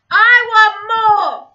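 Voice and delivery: loud, high demand; yelling. Acted. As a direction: yell it like a greedy child. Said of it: "she would, um, yell".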